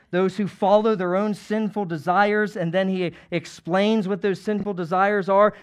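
ordinary delivery

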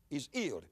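"healed" said as "eeled", without the H; dropped H. Retold or imitated. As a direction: drop the H on HEALED. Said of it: "he didn't pronounce H's".

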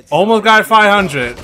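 high, loud and slow, near miss; excited shout. Real, not acted. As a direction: belt it out in excitement. Repeats score excitedly.

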